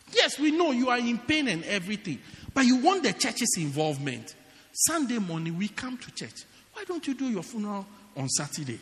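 usual speaking voice

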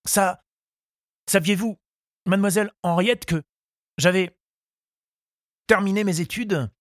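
high, halting voice of a nervous suitor; flustered young suitor. A narrator's reading. Charles tells Henriette his studies are over, flustered. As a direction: stammer it out high, in hesitant bursts. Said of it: "Charles se troublait".

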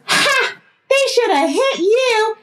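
high, shrieking witch taunt; crazy neighbor yelling from her car like a witch. Retold or imitated. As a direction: screech it like a cackling witch. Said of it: "fading off like a witch".

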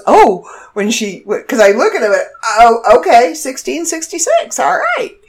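high, lightly voiced drive-thru girl reading back the total; a hint of the surprised girl on the speaker; imitating drive-thru girl. The girl on the speaker, surprised, reads the total back. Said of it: "the girl on the speaker kind of goes".

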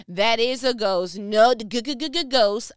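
hammed-up scared stammer, g-g-g-ghost; mock frightened stutter.

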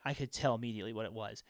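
normal talking voice

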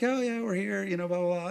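high, airy mock-breezy voice; carefree chatter. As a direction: chirp it like an oblivious holidaymaker. Acting out carefree arrival in Florida.

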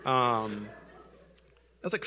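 usual speaking voice

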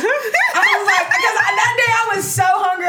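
high, loud squealing retelling with laughter; gushing fan shriek, half laughing; excited squeal. Retold or imitated. Excited retelling of meeting Jazz.

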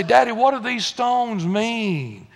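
lightly voiced curious kid; child asking Daddy. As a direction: put the question like a child to a father. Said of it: "The kids are gonna say".

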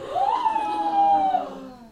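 sharp, very high, loud gasp; hammed-up horrified gasp; loud shocked gasp. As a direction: gasp as if it is too late. Key in the door, too late.

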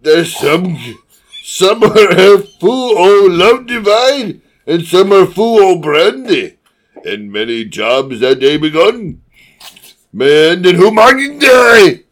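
loud, high verse in garbled Scots-Austrian; Scottish Schwarzenegger. Acted. Co-host reading bawdy verse as Scottish Schwarzenegger. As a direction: declaim the poem in a bad Scottish-Austrian accent. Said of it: "Authentic Scottish Schwarzenegger".

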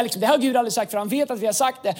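ordinary delivery

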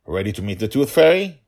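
dad teasing his little one; father dragon. Acted.

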